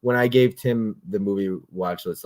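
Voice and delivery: ordinary delivery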